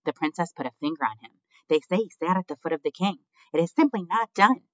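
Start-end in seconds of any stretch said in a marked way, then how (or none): 1.7-4.7 high, loud, scandalized aunt voice; Aunt Florence. A narrator's reading. Aunt objecting from the crowd, scandalized. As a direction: protest loudly in a raised, disapproving pitch. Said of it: "the distinctive voice of Despereaux's Aunt Florence".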